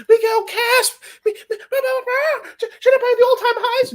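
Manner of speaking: mock the clueless buyer begging to buy the top; shrill mocking voice of chat hyping Caspa; mocking hype-buyer. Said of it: "motherfuckers wouldn't shut the fuck up about Caspa".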